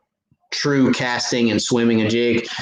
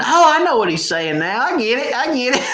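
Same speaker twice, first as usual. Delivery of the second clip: much higher, rising to a shout on I get it; mock viewer realizing; 13 semitones higher than usual. Imitating viewers at home getting the joke, high and shouty. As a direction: ham up a viewer catching the joke. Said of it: "some of you probably are going".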